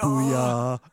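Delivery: shouted booyah cheer; loud booyah. Retold or imitated. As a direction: belt out the booyah. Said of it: "Everyone just went".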